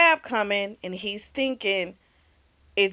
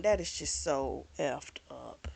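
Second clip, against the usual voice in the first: low disgusted aside; 6 semitones lower, 9 dB quieter than usual. Retold or imitated. Own reaction to villain's plan.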